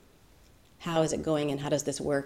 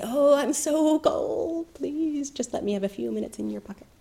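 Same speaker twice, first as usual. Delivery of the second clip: begging cold snake; 9 semitones higher than usual. Acted. Snake begs the rabbit to climb into his pocket, high and pleading. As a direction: whine it out like a shivering snake.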